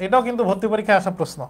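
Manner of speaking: usual speaking voice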